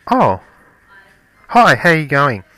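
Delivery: a loud, high put-on polite greeting; fake-friendly hello. Wife pretending to meet the gardener, loud and high. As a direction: act like you've never met him, loud and high. Wife feigns not knowing him.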